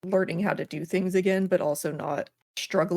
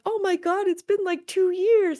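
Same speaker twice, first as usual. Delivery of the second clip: high, gushing villager squeal; excited villager voice; 14 semitones higher, 5 dB louder than usual. Retold or imitated. Villager greeting the returning player, high and gushing. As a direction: play the thrilled neighbour who missed you. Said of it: "They're like".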